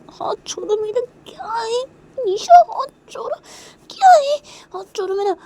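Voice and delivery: very high, loud whiny cry; very high, loud protesting cry; shrill demand. Acted. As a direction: shriek to be let go. Demanding hand be let go.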